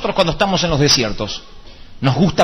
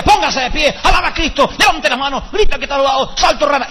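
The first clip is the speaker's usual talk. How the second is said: shouty worship leader; 10 semitones higher than usual. Retold or imitated. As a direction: whip the congregation up like a hyped worship leader. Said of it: "el otro nos decía". (animador de alabanza exaltado, agudo y fuerte)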